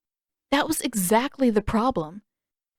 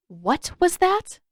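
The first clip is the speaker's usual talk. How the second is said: sharp challenge; 9 semitones higher, slower than usual. Acted. Katara challenging Sokka's mutter.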